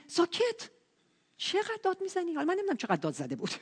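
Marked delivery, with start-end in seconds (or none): 0.1-2.3 imitated telling-off; mock scolding. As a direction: hush him like an irritated bystander. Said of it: "اومدن توبیخش کردن".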